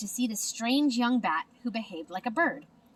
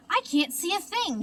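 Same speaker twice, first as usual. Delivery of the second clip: higher mild mock yell; 8 semitones higher than usual. A narrator's reading. Pip yelling while flying blind, a mild mock yell. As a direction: cry out in the dark. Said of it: "yelled Pip".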